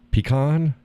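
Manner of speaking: "pecan" as pee-KAHN; pronunciation demo. Shown as a demonstration. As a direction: say "pecan" with long ee and broad ah.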